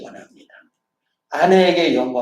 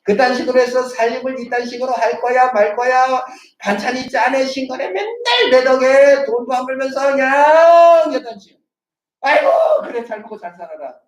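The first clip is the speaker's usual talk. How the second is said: mock nagging husband; 7 semitones higher, 4 dB louder than usual. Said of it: "남편이".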